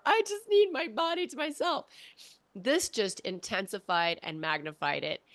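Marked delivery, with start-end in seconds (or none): 0.1-1.9 exhausted mom. Retold or imitated. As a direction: plead for sleep like a worn-out mom.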